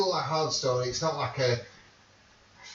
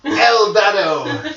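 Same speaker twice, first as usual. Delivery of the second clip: loud, drawn-out, high mock Russian; hammed-up Russian man; fake Russian accent; 6 semitones higher, 9 dB louder, much slower than usual. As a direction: proclaim it slowly as fake Russian. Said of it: "Russian man".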